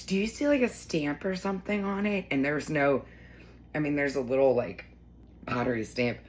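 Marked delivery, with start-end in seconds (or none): 0.1-2.3 higher, playful voice of a friend; imitating her friend. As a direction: lightly play the friend asking with amusement. Friend quoted while laughing.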